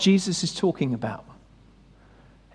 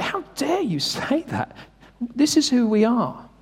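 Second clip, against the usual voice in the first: insulted; 5 semitones higher, 3 dB quieter than usual. Retold or imitated. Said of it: "And so they're insulted".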